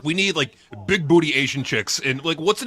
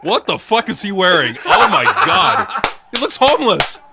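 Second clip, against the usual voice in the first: high, loud laughing oh my God; laughing disbelief; 6 semitones higher, 7 dB louder than usual. Real, not acted. Laughing at Pauly Shore's outfit in the video, loud and high. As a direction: crack up at his outfit.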